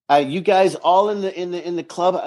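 ordinary delivery

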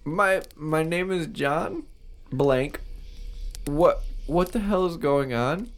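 lost and frightened; lightly voiced panicked man; confused cadaver.

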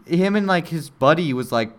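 ordinary talk, nothing marked

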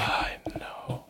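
soft, whispered commiseration; hushed sympathy. Acted. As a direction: breathe it out in a whisper. Sympathy over Terry's coming heartbreak.

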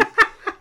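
quick real laugh at a slip; brief burst of laughter; short laugh. Real, not acted. Laughs at own name slip.

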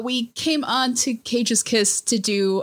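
ordinary talk

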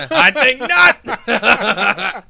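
high, loud, stretched through laughter; laughing catchphrase. Acted. Echoing a friend's reply to the coincidence joke, laughing loudly. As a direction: crack up while declaiming it slowly.

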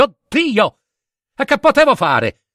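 high, rising panic; panicked outcry. Acted. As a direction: cry out in growing terror. Narratore in preda al panico. (grido di panico)

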